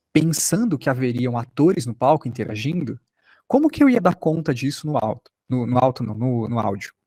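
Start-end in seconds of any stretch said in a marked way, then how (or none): none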